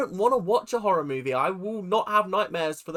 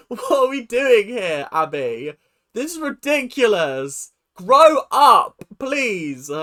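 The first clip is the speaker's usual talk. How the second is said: slow, raised, emphatic scolding; exasperated telling-off; 4 semitones higher, 6 dB louder, slower than usual. Retold or imitated. Telling Abby to grow up, mock-exasperated scolding. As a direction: scold with drawn-out disbelief.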